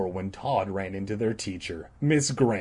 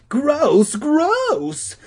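very high, loud shriek of disgust; 7 dB louder than usual. Acted. Beth shrieking at the worm, loud high shriek. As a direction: shriek it, grossed out. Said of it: "Beth was shrieking".

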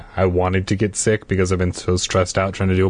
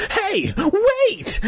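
very high urgent call. Acted. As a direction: call after them. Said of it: "I called".

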